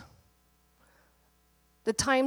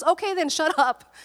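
higher, louder, teasing put-down; blunt friendly scolding; 6 semitones higher, 4 dB louder than usual. Retold or imitated. Friend telling him to stop complaining, blunt and raised. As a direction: shut him up like an exasperated old friend.

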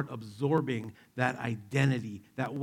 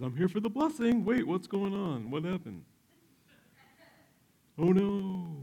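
higher, confused arrival turning to dismay; a brother walking in on the betrayal; surprised Esau; 7 semitones higher than usual. Acted. Esau walks in, discovers trick.

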